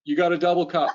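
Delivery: dad ordering. Retold or imitated. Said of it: "every time he goes to Tim Hortons".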